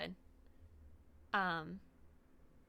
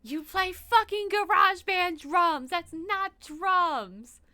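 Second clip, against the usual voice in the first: 1 mock outraged shout; 8 semitones higher, 8 dB louder, much slower than usual. Real, not acted.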